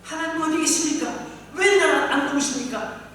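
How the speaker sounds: a suffering believer crying out to God in a loud plea; desperate prayer. Acted. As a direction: plead loudly, feeling abandoned. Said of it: "이렇게 또 기도했을지".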